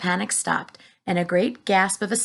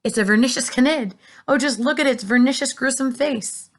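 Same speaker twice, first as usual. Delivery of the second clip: high, loud horrified exclamation; horrified cry; 7 semitones higher, 4 dB louder than usual. A narrator's reading. Police chief crying out in horror. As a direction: recoil at the gruesome face.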